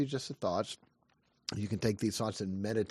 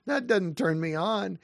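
higher-pitched mock wife's protest; wife's complaint retold in a raised voice; imitated wife; 8 semitones higher, 4 dB louder than usual. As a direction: lightly act out the wife, pitched up. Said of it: "She's like".

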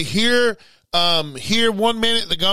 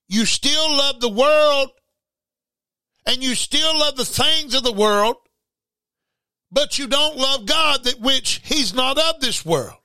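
high, impassioned preaching; raised, ringing pulpit voice; fervent preaching; 7 semitones higher than usual. Acted. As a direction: lift it up and plead with the congregation. Preaching run.

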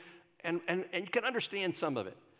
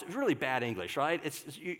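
ordinary talk, nothing marked

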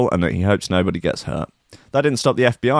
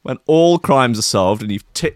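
normal talking voice

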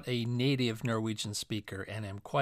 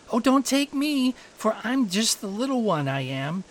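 high, louder plea; pleading little goat; 7 semitones higher, 4 dB louder than usual. A narrator's reading. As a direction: beg the troll as the littlest goat.

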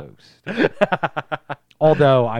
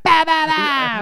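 kung fu fight noises; 11 dB louder, much slower than usual. Retold or imitated. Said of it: "He'd be doing that".

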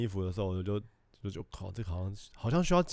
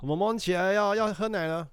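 higher sing-song wake-up call; father waking his child for milk, high and coaxing; coaxing dad; 8 semitones higher, slower than usual. Retold or imitated. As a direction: gently coax a sleepy kid out of bed. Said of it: "那我表演他今天早上发生的事".

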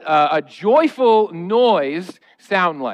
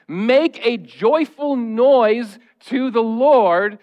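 scripture reading; 4 semitones higher, much slower than usual. Acted. Reads scripture aloud.

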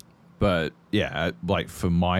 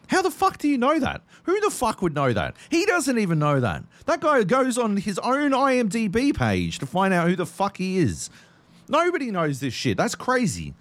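incredulous. Retold or imitated. Imitating his own reaction to wife.

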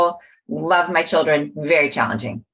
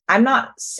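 ordinary delivery

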